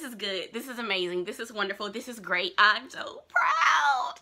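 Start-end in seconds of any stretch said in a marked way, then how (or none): 2.6-4.1 proud shout. Real, not acted. Gushing about Jackson's achievements.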